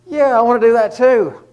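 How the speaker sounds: high, loud burst of eager excitement; excited Peter. Retold or imitated. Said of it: "it gets Peter so excited".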